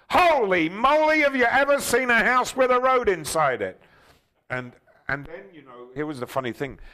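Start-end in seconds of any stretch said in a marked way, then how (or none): 0.1-3.8 high, very loud showman's holler; excited scream. Acted. Host shows his reaction to a huge house, amazed scream. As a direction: shout it out in sheer amazement, pitched way up. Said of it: "going to scream and say".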